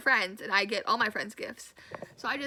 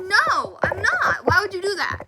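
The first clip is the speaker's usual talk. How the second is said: much higher, loud denial; high incredulous objection; 8 semitones higher, 9 dB louder than usual. Retold or imitated. Confronting the boy about his lie, high loud denial. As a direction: shriek the denial in disbelief.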